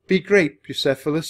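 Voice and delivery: high, emotional farewell; loud, high, touch of heartbreak; voice cracking. A narrator's reading. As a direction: send him off with a catch in the voice. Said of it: "his voice cracking".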